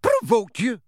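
high, incredulous shout; indignant high-pitched outburst; outraged shout. A narrator's reading. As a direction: throw it back at them, outraged. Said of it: "shouted Professor McGonagall".

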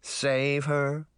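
mildly wary, doubtful question; guarded boy's query; suspicious. A narrator's reading. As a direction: echo it with a touch of suspicion. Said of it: "the boy asked suspiciously".

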